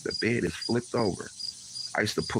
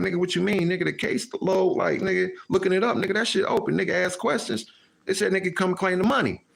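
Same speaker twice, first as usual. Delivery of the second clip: protesting; 9 semitones higher than usual. Retold or imitated. Protesting to cousin.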